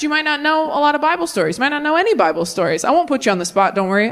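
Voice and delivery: normal talking voice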